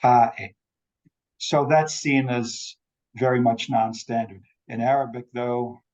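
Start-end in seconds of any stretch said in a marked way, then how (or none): 0.0-0.5 "pa-e" with a glottal stop for the t; Mancunian glottal stop. Retold or imitated. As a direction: imitate a broad Mancunian "pa-e". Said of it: "he said things like".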